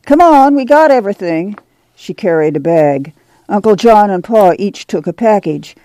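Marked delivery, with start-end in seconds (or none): 0.0-1.5 high, raised call to the family; mother calling her folks along. A narrator's reading. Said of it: "Ma called".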